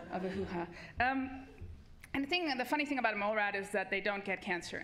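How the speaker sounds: ordinary talk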